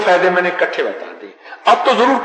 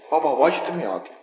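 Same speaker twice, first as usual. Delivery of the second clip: normal talking voice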